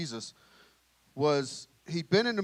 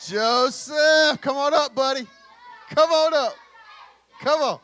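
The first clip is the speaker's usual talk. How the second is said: calling out; 8 semitones higher, 8 dB louder, slower than usual. Real, not acted. Teacher calls a kid up.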